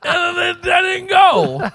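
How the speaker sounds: laughing shout. Real, not acted. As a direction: blurt it out laughing. Laughing confession.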